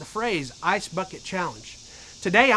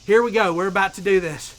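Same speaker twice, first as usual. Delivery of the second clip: usual speaking voice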